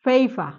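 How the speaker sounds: "ফেইফা" ending in an open a, no R; silent final R. Shown as a demonstration. Teacher showing the P sound to the class, silent final R. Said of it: "আরটাকে উচ্চারণ করছি না".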